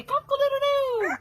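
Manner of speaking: cock-a-doodle-doo crowed high like a rooster. Shown as a demonstration. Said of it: "Let's hear a rooster".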